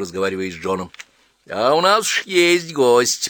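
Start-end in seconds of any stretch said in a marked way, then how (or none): 1.5-3.3 warning. A narrator's reading. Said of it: "предупредила она".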